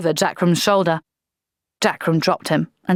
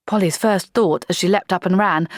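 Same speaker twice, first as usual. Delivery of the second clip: ordinary delivery, nothing marked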